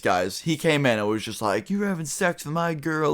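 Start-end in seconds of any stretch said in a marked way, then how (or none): 1.7-3.1 higher-pitched imitation of a pissed-off boyfriend; mock outrage. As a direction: mimic his indignation in a high voice. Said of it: "he was pissed".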